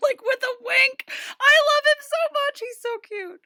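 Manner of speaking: very high, tearful-sounding squeal; emotional gushing. Real, not acted. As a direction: gush in a squeaky, choked-up voice, fading at the end. Gushing over the star's wink.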